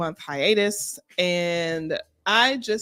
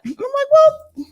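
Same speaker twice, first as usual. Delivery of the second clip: ordinary talk, nothing marked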